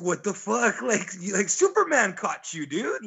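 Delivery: high disbelieving rant; incredulous. Real, not acted. Friend reacting to a Deadshot cartoon, incredulous and high. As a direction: ask it up high like you can't believe it.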